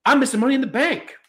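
high, bouncy Australian-New Zealand voice; mock Waller in a lively Australian accent; a playful Aussie-Kiwi impression, pitched high. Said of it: "That was a good accent".

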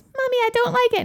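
put-on very high child whine; little kid complaining. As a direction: protest like a toddler. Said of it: "she goes".

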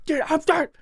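high, loud, exasperated; fed-up shout. Retold or imitated. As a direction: yell it like you're at your limit. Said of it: "hear you're like".

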